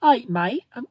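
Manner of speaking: loud, high mock British greeting; attempted British accent. Shown as a demonstration. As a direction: belt out a put-on British accent. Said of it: "I'll say it in a British accent".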